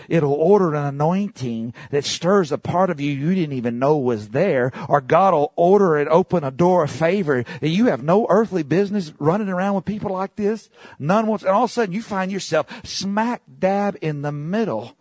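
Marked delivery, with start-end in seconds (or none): 4.9-14.9 rising, louder preacher's climb; preaching at full force. Real, not acted. Preacher to the congregation, climbing high and loud. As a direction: build the sermon to a high, loud peak.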